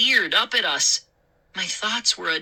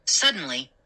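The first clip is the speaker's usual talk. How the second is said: usual speaking voice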